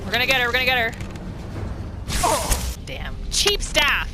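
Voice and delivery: normal talking voice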